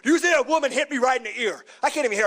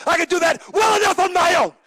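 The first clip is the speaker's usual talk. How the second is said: shouted, higher and louder; sudden shout; 9 semitones higher, 5 dB louder than usual. Real, not acted. Champion yells at Charlie Haas in his promo. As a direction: blow up on the line. Champion mocks himself, erupting.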